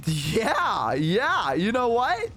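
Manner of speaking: drawn-out, hammed-up nervous yeahs, rising at the end; forced agreement. Spooked man humouring his date's plan, forced agreement. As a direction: stall with exaggerated, uneasy agreement.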